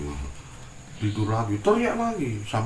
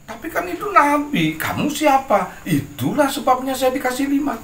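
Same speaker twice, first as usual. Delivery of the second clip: triumphant cheeky retort; 12 semitones higher, 6 dB louder than usual. Acted. Abu Nawas's cheeky retort to king.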